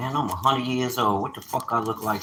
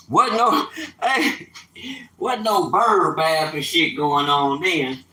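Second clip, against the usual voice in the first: mock outrage; 6 semitones higher, 4 dB louder, slower than usual. Mocking outrage at shared showers.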